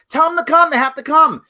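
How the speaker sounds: high, loud commanding yell; demanding yell. Retold or imitated. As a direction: shout the order at the sergeant. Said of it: "finally, I yelled at the".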